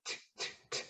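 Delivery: "t" repeated as a bare unvoiced burst; voiceless t. Shown as a demonstration. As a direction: puff out "t, t, t" with no voice. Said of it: "and then you say t".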